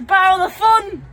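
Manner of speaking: loud, high gleeful yell; excited shout. Pretend. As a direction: shout it out with glee. Repeats the phrase, quiet then shouted.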